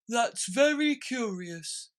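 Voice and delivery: slow, high, loud musing turtle; solemn slow turtle; Mock Turtle, deep breath. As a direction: ponder it with a sigh. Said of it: "drew a long breath and said".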